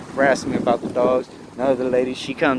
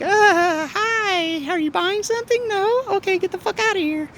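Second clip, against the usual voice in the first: very high, fake-sweet barista voice; mock the fake smile with a squeaky falsetto. Mocking Dutch Brothers staff.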